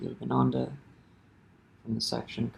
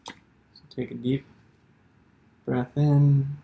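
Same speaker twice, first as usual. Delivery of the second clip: guided meditation. Real, not acted. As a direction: lead them gently inward, leaving space between phrases.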